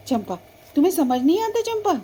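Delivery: loud, high scolding; telling off the cow; angry scolding. Acted. (ऊँची आवाज़ में डाँटना)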